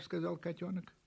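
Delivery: ordinary delivery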